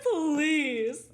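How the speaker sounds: loud, high, dragged-out scoff; mock outrage. Retelling her scoff at the friend's verdict, indignant. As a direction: stretch it out in hammed-up disbelief.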